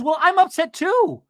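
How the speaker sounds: high, loud, drawn-out comeback; heated argument impression; imitated angry retort. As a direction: fire back loudly in mock upset. Acts out Emily arguing back.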